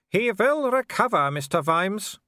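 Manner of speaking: high, loud villain's call; Wulf. Acted. Werewolf calls to Vimes in the boat, high calling taunt. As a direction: call it out high, lifting at the end. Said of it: "then cupped his hands again".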